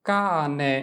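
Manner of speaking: ordinary delivery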